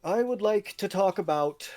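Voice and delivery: a commoner speaking up before the court; commoner's halting voice. Acted. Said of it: "the man kind of says".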